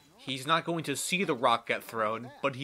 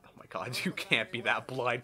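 usual speaking voice

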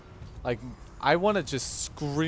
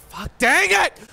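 high, loud outburst; exasperated shouted curse; frustrated yell; 11 dB louder, slower than usual. Real, not acted. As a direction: explode at a botched play. Curses at a failed play.

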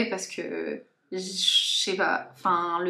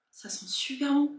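whispering; 5 semitones higher than usual. Real, not acted. Smelling the lip gloss.

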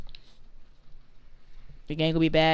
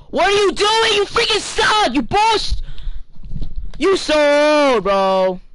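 screaming, much higher and louder; furious shout; 12 semitones higher, 8 dB louder, much slower than usual. Real, not acted. Gamer raging at a teammate who lost the ball, furious scream. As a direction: blow up at a useless teammate.

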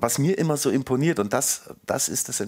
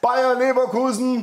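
stadium announcer; 11 semitones higher, 4 dB louder than usual. Acted.

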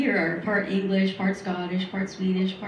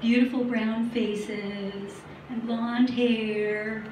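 ordinary delivery

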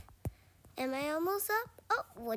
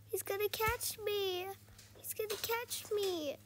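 anxious; 6 semitones higher, 4 dB quieter than usual. Real, not acted. Chased by game monster.

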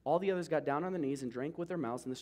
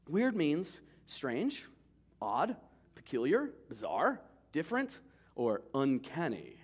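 drawn-out list, each word savoured; slow dictionary read; 4 semitones lower, slower than usual. Shown as a demonstration. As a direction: read the definitions slowly. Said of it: "especially if you say it like that".

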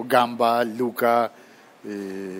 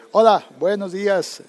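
loud, high announcer hello; big greeting; 10 semitones higher, 11 dB louder than usual. Acted. Host opening the live show, booming cheerful hello. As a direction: burst in like a radio host.

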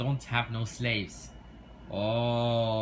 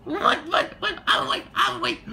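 much higher, louder flustered babble; jolted awake and flustered; high panicky just-woke-up voice; startled waking; 6 dB louder than usual. Acted. Cat just woken up.

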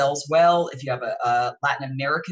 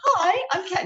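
mock female announcer; 3 dB louder than usual. Mock announcer intro after news voice joke.

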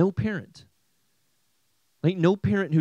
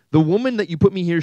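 loud, brisk accusation, higher pitched; quicker blame; 4 semitones higher, 8 dB louder, faster than usual. Acted. Adam's answer read to the congregation, loud and brisk. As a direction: rattle off the blame loudly. Reads Adam's line again, faster.

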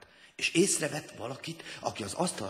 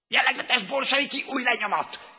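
high, loud outcry; extremist's shout; 13 semitones higher, 8 dB louder than usual. A narrator's reading. Extremist inside the coat, shouting high. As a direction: shout it like an accusation. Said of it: "kiáltotta egy szélsőséges a kabátújából".

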